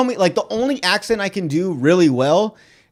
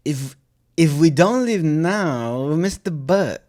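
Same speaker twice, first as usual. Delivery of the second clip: slow, clumsy German accent; German attempt sliding towards Borat; slower than usual. Shown as a demonstration. Practising a line in German accent for a friend. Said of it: "then I'll say it in German".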